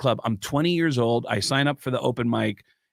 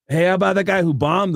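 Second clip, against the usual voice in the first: higher, louder taunt; mocking comic; 6 semitones higher, 5 dB louder than usual. Open micer deflecting his own failure, mocking call-out. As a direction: call someone out to the crowd. Mimics comics heckling him from stage.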